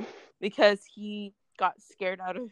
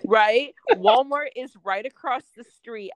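higher and louder, fed up and incredulous; exasperated outburst; 4 semitones higher, 9 dB louder than usual. Retold or imitated. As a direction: hit it loud like you can't believe his excuse. Exasperated rant at baby dad.

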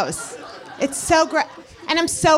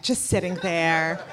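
normal talking voice

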